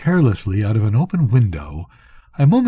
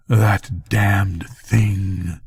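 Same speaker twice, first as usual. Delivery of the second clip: slow, husky, a touch lower; husky and unnatural; 4 semitones lower, much slower than usual. A narrator's reading. Morgan answering without turning, slow and husky. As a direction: drag the words out, husky and strange. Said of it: "His voice was husky and unnatural".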